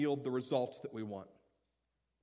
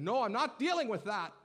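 stubborn refusal; 8 semitones higher, 6 dB louder than usual. Acted. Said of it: "looking at God and saying".

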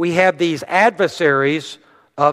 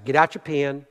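normal talking voice

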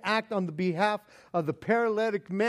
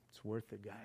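soft content murmur; 11 semitones lower, 18 dB quieter, faster than usual. Retold or imitated. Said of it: "Maybe he's going".

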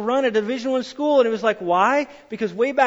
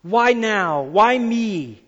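complaining at God; 4 dB louder, much slower than usual. Retold or imitated. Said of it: "looking at God and saying".

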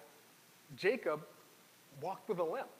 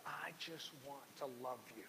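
tender; 3 semitones lower, 9 dB quieter than usual. Retold or imitated. God speaking tenderly to us.

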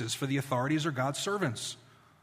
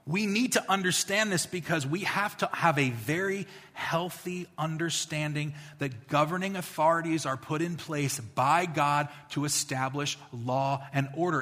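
ordinary talk

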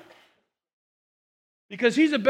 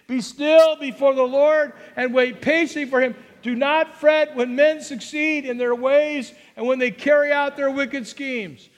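higher, louder scripture proclamation; proclaimed reading; 4 semitones higher, 5 dB louder than usual. Shown as a demonstration. Preacher leads the congregation in reading the psalm, proclaiming. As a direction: read it out strongly. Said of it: "Let's read it".

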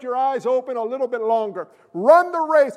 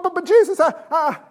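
high, loud stammer; flustered believer; 5 semitones higher, 5 dB louder than usual. Acted. Believer interrupting Jesus to confess, high flustered stammer. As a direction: sputter a protest, stuck on the words.

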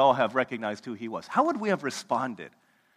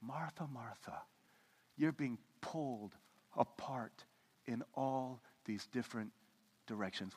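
Jesus softly correcting Martha; gentle Jesus; 2 semitones lower, 12 dB quieter than usual. Retold or imitated. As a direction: call her name gently, like a caring friend. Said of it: "Jesus is saying".

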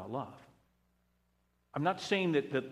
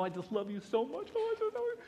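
high, cooing mock gush; mocking touchy-feely gushing; gooey mock sweetness; 13 semitones higher than usual. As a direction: ham up a syrupy "I love you". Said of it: "kind of gooey stuff".

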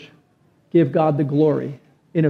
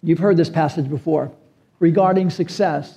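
ordinary talk

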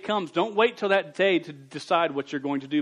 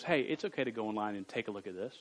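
tempting inner voice; 4 semitones lower, 7 dB quieter than usual. Retold or imitated. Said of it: "a voice in your head just goes".

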